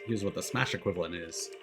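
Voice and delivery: ordinary talk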